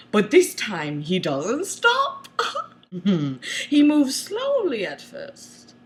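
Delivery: ordinary delivery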